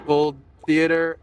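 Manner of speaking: ordinary delivery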